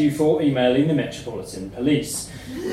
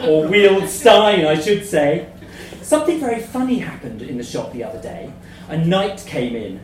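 usual speaking voice

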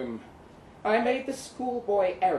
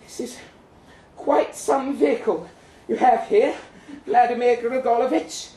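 higher and louder, a touch out of breath yet sweet; panting good humor; 8 semitones higher, 7 dB louder than usual. Acted. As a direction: pant it cheerfully while pushing the chair. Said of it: "little darling panted, her voice still honeyed".

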